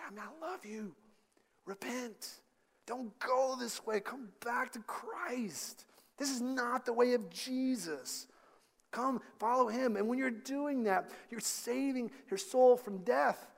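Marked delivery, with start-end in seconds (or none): 0.0-10.0 soft, high, hushed urging; tender plea. Acted. Preacher with an arm round a straying brother, tender plea. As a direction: plead softly and slowly, drawing them back.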